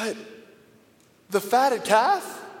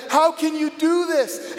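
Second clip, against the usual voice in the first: raised, heated demand; angry outburst; 6 semitones higher than usual. Retold or imitated. Older son confronting his father, angry and raised. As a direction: fling the question at him, voice up. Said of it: "I'm sorry I'm so angry".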